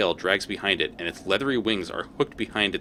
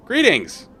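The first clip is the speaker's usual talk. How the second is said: high, louder, bright hello; loud cheery greeting; 13 semitones higher, 7 dB louder than usual. Pretend. Player loudly hails the scary devil, cheery shout. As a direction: throw out a big eager hello.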